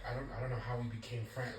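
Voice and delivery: low, soft, quick mumbled mock Cody. Vlogger mocks Cody Rhodes muttering about friendship. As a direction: mumble it like an unsure man. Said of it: "he just sounded so unsure".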